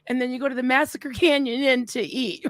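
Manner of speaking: ordinary talk, nothing marked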